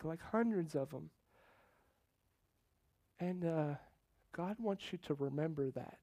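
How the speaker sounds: soft, halting, searching delivery; hushed prophecy. Retold or imitated. Woman prophesying over the preacher as she prays, hushed and halting. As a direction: quietly feel out each image as it comes.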